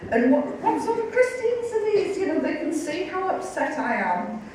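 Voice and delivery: high-pitched, indignant inner rant; exasperated complaint. Retold or imitated. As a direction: whine in retold outrage at their heartlessness. Upset at no-smoking cell mates.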